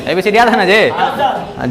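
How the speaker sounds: normal talking voice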